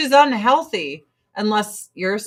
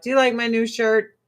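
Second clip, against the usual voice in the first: ordinary talk, nothing marked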